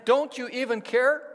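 loud, high pleading cry; loud desperate "Don't you even care?"; desperate plea. Acted. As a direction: shout it like panicked fishermen in a storm.

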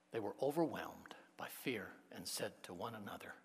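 whispered, awed narration; quiet awed whisper; hushed reading. A narrator's reading. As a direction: read it under your breath with dread. Reading fear line in whisper.

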